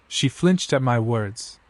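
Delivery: plain reading voice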